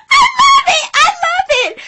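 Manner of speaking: very high, loud, laughing "I love it"; gleeful high cry fading to laughter; delighted squeal. Real, not acted.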